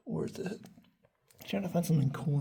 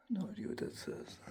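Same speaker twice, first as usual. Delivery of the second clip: whispering; 5 semitones higher, 8 dB quieter than usual. Real, not acted. Eerie cave, quiet reading of graffiti.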